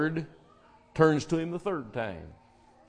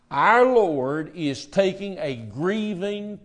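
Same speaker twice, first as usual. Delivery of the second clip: usual speaking voice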